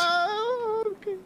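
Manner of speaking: high startled cry; startled yelp. Real, not acted. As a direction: yelp in surprise. Startled while gliding.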